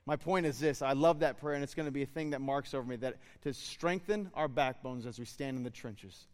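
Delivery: ordinary talk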